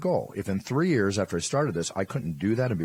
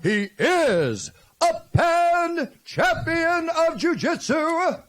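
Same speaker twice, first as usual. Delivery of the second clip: announcer call; 5 dB louder, much slower than usual. Shown as a demonstration. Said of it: "you say what I tell you".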